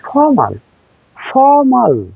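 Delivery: ফর্মাল high, long British faw-mal, no R. Shown as a demonstration. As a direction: stretch British ফর্মাল without the R. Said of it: "প্রনাউন্সিং এ ওরা বলে".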